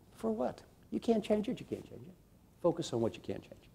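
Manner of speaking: soft, dropping away; hushed, resigned advice; quiet resignation. Real, not acted. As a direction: let the words fade out. Resigned aside then soft advice.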